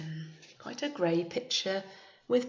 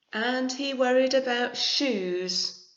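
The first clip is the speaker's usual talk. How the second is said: story narration; 5 semitones higher, 6 dB louder, slower than usual. A narrator's reading. Reads picture book aloud.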